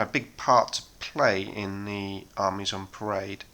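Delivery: ordinary delivery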